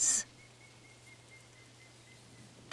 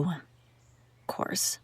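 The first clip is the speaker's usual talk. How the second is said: whispered aside; 9 dB quieter than usual. Acted. Muttered aside to herself.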